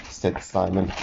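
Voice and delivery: usual speaking voice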